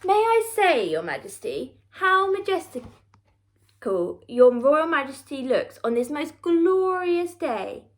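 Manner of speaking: slither the compliments out; creepy fawning painter. Acted. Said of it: "creeped the royal portrait painter".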